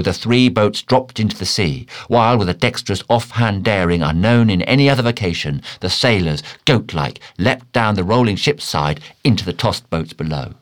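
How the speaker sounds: plain reading voice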